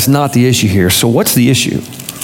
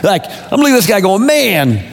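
high, driving sermon delivery; indignant preaching; 8 semitones higher than usual. Real, not acted. Preacher confronting the hypocritical servant, indignant preaching. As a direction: hammer the accusation with high pitch.

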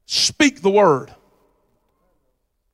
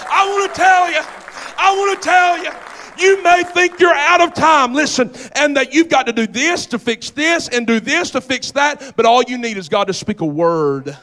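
loud, raised revival preaching; preacher building to a shout, then driving on; full-force preaching; 5 semitones higher, 5 dB louder than usual. Real, not acted. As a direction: cry it out to the congregation.

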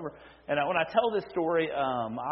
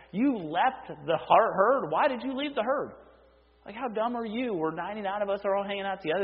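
higher, exasperated voice chiding a lost sheep; half-teasing rebuke, pitched up and pressing; Jesus scolding the stray; 6 semitones higher than usual. Acted. Jesus chiding the sheep that wandered from the herd, pitched-up exasperated scolding.

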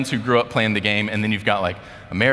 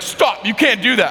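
high, loud imagined telling-off; mock scolding; 11 semitones higher, 5 dB louder than usual. Teammates scolding a newcomer's pass, loud mock scolding. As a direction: halt him with a raised, high voice.

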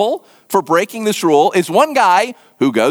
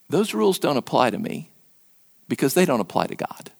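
low, soft, certain statement; hushed, weighty declaration; quiet authority; 7 semitones lower, 7 dB quieter than usual. Acted. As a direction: drop the voice well down and state it calmly. Voicing Jesus's answer to authorities.

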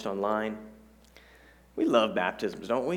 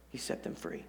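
breathy whisper, much softer; whispered echo; 6 semitones lower, 12 dB quieter than usual. Acted. Pastor whispering the promise back to the congregation. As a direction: whisper it like a secret truth. Pastor echoing line for emphasis.

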